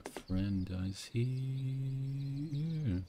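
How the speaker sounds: drawn-out, dreamy quoted line; slow quoted line. Retold or imitated. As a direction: stretch it out slowly, like a film line. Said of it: "Such a great line".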